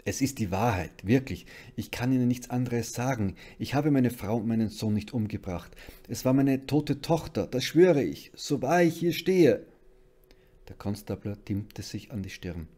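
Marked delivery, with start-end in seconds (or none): 0.0-9.6 loud, desperate pleading; distraught protest, building louder and higher; sobbing. A narrator's reading. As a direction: plead tearfully to be believed, rising in pitch. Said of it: "schluchzte seufzend auf".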